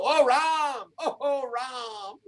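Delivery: high wailing call to Ram; mock anguished cry. Shown as a demonstration. Teacher shows the class how to cry out, mock wail. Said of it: "put up your hands and go".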